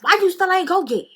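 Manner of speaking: bossy friend voice, hammed up; imitating her way of talking. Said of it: "That's how she talk".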